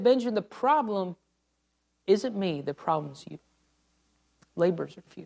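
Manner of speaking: quiet, low final verdict; solemn divine rebuke fading down; voice of God, dropping. Retold or imitated. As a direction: let it fall to a hush on you. God's voice again, softer end.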